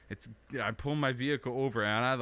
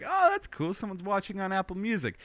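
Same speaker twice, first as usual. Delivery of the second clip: mock delighted surprise; 8 semitones higher than usual. Quoting his own surprise at an Apple Music listener, mock delighted. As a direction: pitch jumps way up on oh, then a bright mock-impressed voice. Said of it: "And I was like".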